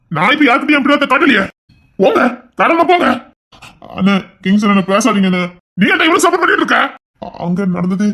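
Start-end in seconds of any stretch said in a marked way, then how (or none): 0.1-3.1 high-pitched, exasperated outburst; heated and defiant; angry retort. Acted. Heated quarrel, telling others to go. 5.8-6.8 fast, high, indignant burst; rapid heated accusation; angry challenge. Acted. Quarrel, challenging the peacemaker.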